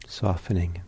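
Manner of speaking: measured meditation guidance with long pauses; calm, unhurried meditation teacher; guided meditation. Real, not acted. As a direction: ease listeners into stillness, leaving space between phrases.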